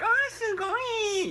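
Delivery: very high, slow, squealing Oh, sugoi!; squealing fangirl. Retold or imitated. Teacher mocking fans, squealed sugoi. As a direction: squeal it like an overexcited fan. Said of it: "some people will be like".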